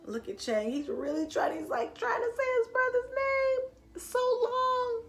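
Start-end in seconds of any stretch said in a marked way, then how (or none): none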